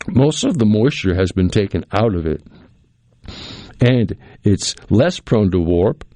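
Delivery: ordinary delivery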